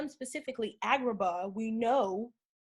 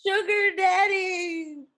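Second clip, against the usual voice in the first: excited shout; 9 semitones higher, 8 dB louder, much slower than usual. Real, not acted. Teasing remark about a cartoon villain.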